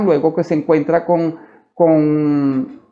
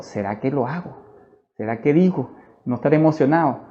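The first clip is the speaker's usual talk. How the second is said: softer, lower musing to himself; re-enacted private doubt, voice dropped; hesitant self-questioning; 3 semitones lower, 6 dB quieter than usual. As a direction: wonder it under your breath, unsure. Doubting to himself before acting.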